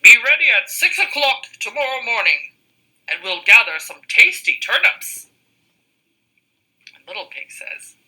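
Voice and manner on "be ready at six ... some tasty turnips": wolf invitation. Acted. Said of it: "called the wolf".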